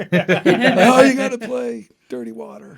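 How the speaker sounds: high, loud mock heckle; pushy audience heckler. Audience member interrupts the band's set with a request, mock heckle. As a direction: shout out a song request like a pushy fan.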